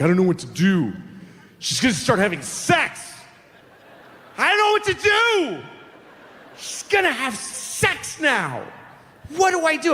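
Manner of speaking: high, frantic worried father; panicked dad. Acted. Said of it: "he's like".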